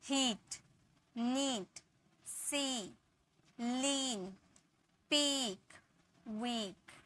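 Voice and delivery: soft word list, each ee held: हीट, नीट, सी, लीन, पीक, वीक; हीट, नीट, सी, लीन, पीक, वीक as separate long-vowel model words; stretched long ee. Shown as a demonstration. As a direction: draw out the ee in हीट through वीक. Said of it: "थोड़ा टान के sound निकलता".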